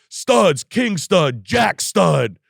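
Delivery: high, loud gleeful rattle; man gushing about framing, loud; excited list. Real, not acted. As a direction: pump up each item.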